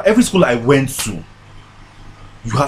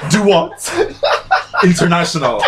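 teasing through laughter; mock-impressed, laughing; ribbing a friend while laughing; 5 semitones higher, slower than usual. Real, not acted. Teasing about foreign trips.

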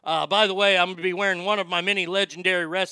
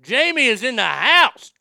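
hype announcement; 5 semitones higher, 8 dB louder than usual. Acted. Hyping a guest's arrival.

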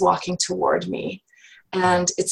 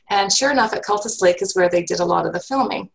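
normal talking voice

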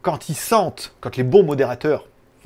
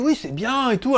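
chargée de com imitée; 9 semitones higher than usual. Retold or imitated.

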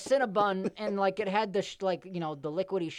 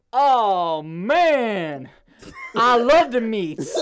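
slow, woozy mock girl, a bit louder; dazed girl; 8 dB louder, much slower than usual. Imitating the mall girl to friends, slow woozy drawl. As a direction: drag it out like she's half knocked out. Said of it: "she goes like this".

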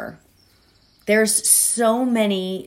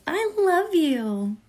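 1 slow tender; 10 semitones higher, much slower than usual. Shown as a demonstration. Mirror affirmation demo, slow.

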